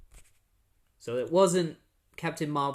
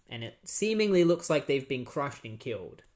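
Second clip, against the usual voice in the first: ordinary delivery, nothing marked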